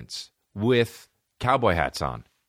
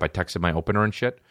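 normal talking voice

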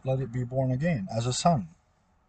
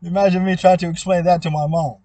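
loud, laughing through the words; 4 semitones higher, 9 dB louder than usual. Real, not acted. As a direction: burst out laughing as you say it. Laughing at own story.

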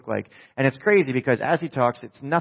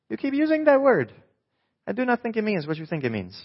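movie quote impression; 9 semitones higher than usual. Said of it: "Movie buffs".

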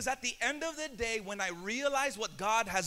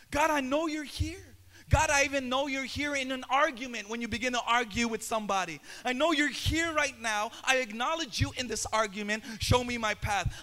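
fervent prayer; 10 dB louder than usual. Acted. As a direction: pray it, swell with each line until you cry out. Prayer swelling to a shout.

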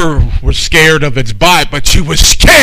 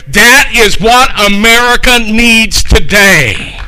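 loud, rising preacher's call, higher and louder; climactic preaching; 7 semitones higher, 4 dB louder than usual. Acted. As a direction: build the sermon to a rousing climax. Builds the sermon to a climax.

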